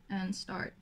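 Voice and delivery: ordinary talk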